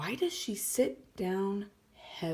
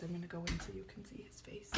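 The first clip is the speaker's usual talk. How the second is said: quick hushed whisper to the class; 14 dB quieter, much faster than usual. Real, not acted. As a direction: lean in and whisper it fast. Teacher zooming in on picture.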